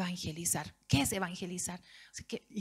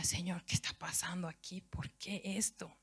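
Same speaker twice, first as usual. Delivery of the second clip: soft, breathless whisper to God, slowing at the end; whispered prayer; 8 dB quieter than usual. Retold or imitated. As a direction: plead quietly, almost voiceless. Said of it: "Yo decía". (oración susurrada y angustiada)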